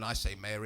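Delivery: softened "Mayer", low and quiet. Shown as a demonstration. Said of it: "It's friendlier. It's less aggressive".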